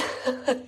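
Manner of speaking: quick breathy laugh; short airy chuckle; breathy giggle. Real, not acted. Laughs at own joke.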